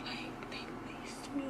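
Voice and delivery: "they laced me" whispered, unvoiced and slow. Telling herself she was drugged, in a frightened whisper. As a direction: breathe it like a scared secret. Paranoid realization, whispered aside.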